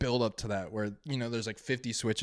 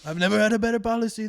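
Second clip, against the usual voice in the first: fast boastful Trump voice, higher and louder; Trump impression; 9 semitones higher, 6 dB louder, faster than usual. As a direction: brag about the policy like Trump. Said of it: "the Trump impression".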